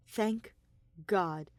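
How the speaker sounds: plain reading voice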